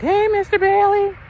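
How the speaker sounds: very high, sing-out greeting; exaggerated high-pitched hello; greeting principal. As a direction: imitate a chirpy principal saying hello. Imitates principal greeting him.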